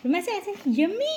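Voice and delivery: quick, high modelling of yummy with a rising end; mother demonstrates yummy for her toddler, high and quick; modelling the word. Shown as a demonstration. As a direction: show the toddler how to say yummy, high and bright. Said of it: "ऐसे करें।".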